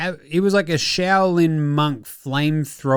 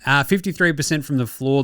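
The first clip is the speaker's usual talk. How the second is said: ordinary talk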